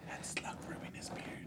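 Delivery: soft whisper; hushed aside in a whisper. Pretend. Joins the whispered ASMR bit.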